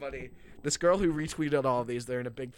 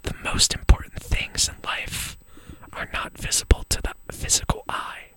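breathy whisper close to the mic; giggly prank whisper; 10 semitones higher, 3 dB louder than usual. Acted. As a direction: whisper it like a mock guru. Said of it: "just whisper into the mic".

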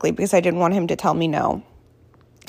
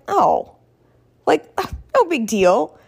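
put-on unimpressed kid; 6 semitones higher, slower than usual. Daughter shrugging off the swear.

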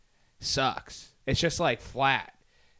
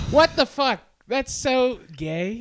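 mock outraged viewer; 13 semitones higher, 11 dB louder, slower than usual. Mocking viewer reaction.

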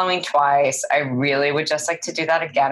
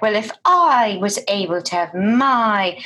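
exaggerated British transatlantic accent, higher; 5 semitones higher than usual. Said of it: "make it as transatlantic as possible".

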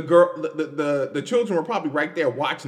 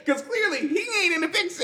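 laughing high; 10 semitones higher, faster than usual. Real, not acted. Laughing at the absurdity.

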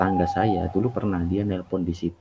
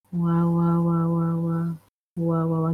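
eerie repeated wah wah, pitched up; muffled wah wah talk; 8 semitones higher, slower than usual. Retold or imitated. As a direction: imitate a distant ghostly wah wah. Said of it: "saya kedengar suara kayak".

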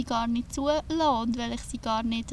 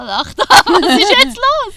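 much higher and louder, laughing; 11 semitones higher, 17 dB louder than usual. Real, not acted. Laughing at herself while talking about anger. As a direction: break into laughter mid-sentence. Bursts out laughing mid-sentence.

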